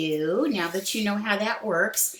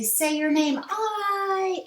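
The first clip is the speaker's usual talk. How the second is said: magic E voice; 12 semitones higher, much slower than usual. Pretend. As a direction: call out like the E giving an order. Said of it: "it's gonna say".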